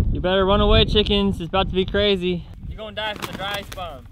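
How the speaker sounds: high, loud hyped-up warning; excited taunting. Real, not acted. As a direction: hype up the chaos. Taunting chickens before the bomb.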